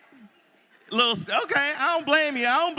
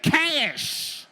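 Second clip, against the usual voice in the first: heckling lady; 6 semitones higher than usual. Retold or imitated. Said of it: "the lady in the back stood up and said".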